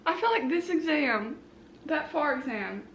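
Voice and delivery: usual speaking voice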